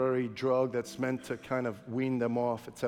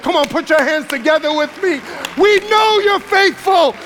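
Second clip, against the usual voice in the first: full-force preaching; 10 semitones higher, 15 dB louder than usual. Acted. Pastor calling the congregation to praise, full-force shouting. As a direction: shout it to the rafters.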